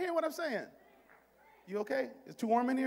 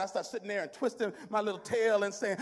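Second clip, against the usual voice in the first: normal talking voice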